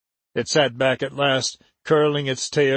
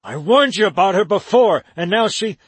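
higher and louder, accusing; angry accusation; 5 semitones higher, 4 dB louder than usual. A narrator's reading. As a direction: throw the warning back at her. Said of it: "Weldon hissed".

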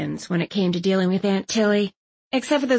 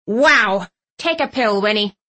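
ordering; 4 dB louder than usual. A narrator's reading. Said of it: "Aunt Tilly ordered".

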